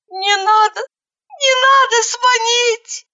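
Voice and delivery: high, drawn-out pained moan. A narrator's reading. Injured Zhanna begging her not to call, moaning. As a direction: plead in a thin, slow wail. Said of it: "простонала Жанна".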